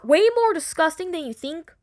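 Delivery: usual speaking voice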